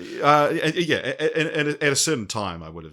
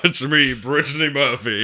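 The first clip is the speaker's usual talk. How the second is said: Brittany Murphy voice; 5 semitones higher, 5 dB louder than usual. Retold or imitated.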